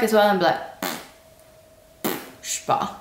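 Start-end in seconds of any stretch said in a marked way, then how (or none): 2.4-3.0 pursed-lip puff of air; lip raspberry. Shown as a demonstration. Showing the French don't-know raspberry. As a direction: blow a short raspberry. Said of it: "making a bit of a fart sound".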